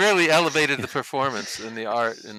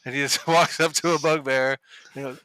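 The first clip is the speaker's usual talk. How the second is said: ordinary talk, nothing marked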